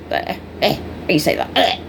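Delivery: low, soft, breathy grunted eh eh; mock bark. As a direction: imitate a grumpy person barking back. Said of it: "You barked at 'em".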